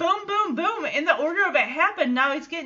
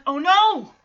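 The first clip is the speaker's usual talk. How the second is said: louder, higher mock-alarmed outburst; alarmed cry; 4 semitones higher, 7 dB louder than usual. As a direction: act out sudden dismayed realization. Joey realizes his mistake, acted out.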